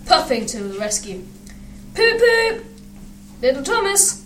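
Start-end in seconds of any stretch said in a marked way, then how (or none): 1.9-4.2 very high imitated engine whistle; train whistle. A narrator's reading. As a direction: toot like a whistle, then tease in a high voice. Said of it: "he whistled".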